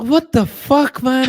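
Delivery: on request, a high, loud, stretched "what the fuck"; catchphrase on request. Shown as a demonstration. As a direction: belt out the signature line. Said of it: "Say it, say it".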